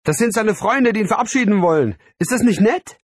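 very high, loud, gushing mother; delighted calling out. A narrator's reading. Mother thinks friends are saying goodbye, delighted. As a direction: gush with thrilled enthusiasm. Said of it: "rief meine Mutter begeistert".

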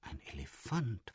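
hushed, barely voiced words; whispered. A narrator's reading. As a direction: breathe it out in wonder. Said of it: "flüsterte Peter".

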